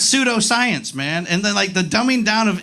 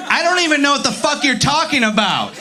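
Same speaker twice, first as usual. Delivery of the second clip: higher-pitched, fed-up mock shout; exasperated outburst; 5 semitones higher than usual. Comic answers roommate's muddled story, exasperated outburst. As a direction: lose patience with a rambling storyteller.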